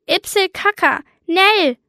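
höher, kindlich drängend verkündet; Tante Lisbeth. Acted. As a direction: wie eine aufgeregte Tante, die dringend aufs Klo muss. Tante Lisbeth verkündet Klo-Not. (Tante Lisbeth meldet der Nichte im Flugzeug dringend: hohe, kindlich drängende Stimme)

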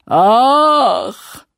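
loud, high sobbing sigh. Acted. The weeping sea king groaning to himself, loud sob. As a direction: wail it out like a crying giant. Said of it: "schluchzte er".

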